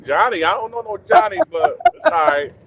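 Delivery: high, unbothered Jamaican shrug; Jamaican accent. Retold or imitated. The worker shrugging off news of Johnny, Jamaican accent. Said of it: "the man is like".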